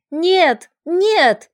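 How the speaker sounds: high, loud frantic refusal; panicked cry. A narrator's reading. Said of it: "закричала вдруг странная женщина".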